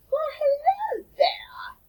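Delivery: high, airy, slow mermaid greeting; sing-song the greeting like a dreamy mermaid. Acted. Mermaid greets the children, high airy mermaid voice. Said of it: "and she says".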